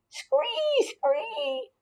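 high, thin screech; screaming frog. A narrator's reading.

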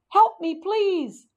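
high, drawn-out plea; squeaky call for rescue; yelling for help. A narrator's reading. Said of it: "why I was yelling".

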